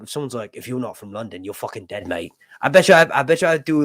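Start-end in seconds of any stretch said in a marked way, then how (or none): 0.6-2.3 lightly voiced London accent, softer; a low-key London-accented threat. Someone pointing a gun at his head, in a light London accent. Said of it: "London accent".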